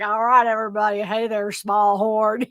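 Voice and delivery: lightly imitated Matthew McConaughey-style talk; a hint of McConaughey in the voice; [name]. Host imitating a lender who sounds like McConaughey, light impression. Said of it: "he sounds exactly like Matthew McConaughey".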